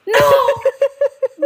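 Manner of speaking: high, dramatic princess no; Disney princess no. Retold or imitated. Friend mocking the niece as a princess, dramatic. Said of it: "como princesa de Disney".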